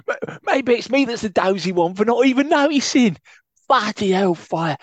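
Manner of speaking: high, loud put-on manager voice; Betis impression. Co-host doing Betis blaming himself. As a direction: grumble in character, exasperated. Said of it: "That went a bit steptoe at the end".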